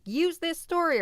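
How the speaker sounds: high, loud, pushy order; mock demanding email. Streamer parodying demanding viewer emails, high and loud. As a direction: bark it like a bossy request. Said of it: "like, demanding".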